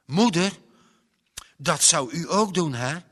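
high, loud eager question; excited loud child asking mother; eager outburst. Acted. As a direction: burst out to mother. Boy bursts out to mother.

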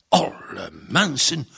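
exclaiming. A narrator's reading. Farmer hearing about men leaving a car, exclaiming. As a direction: let the first word swoop in amazement. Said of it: "riep Gerrit uit".

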